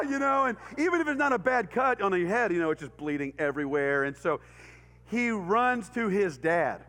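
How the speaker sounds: ordinary talk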